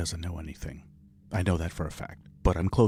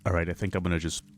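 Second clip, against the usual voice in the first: ordinary delivery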